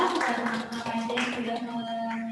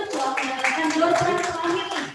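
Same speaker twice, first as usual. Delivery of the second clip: booming prize announcer; 4 semitones higher, 6 dB louder than usual. Real, not acted. MC congratulates winner.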